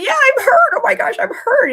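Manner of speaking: put-on very high, loud wailing child voice; whiny toddler. Toddler copying the parent's panic, high and wailing. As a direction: mimic a toddler who's just decided it hurts. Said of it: "then they're gonna say".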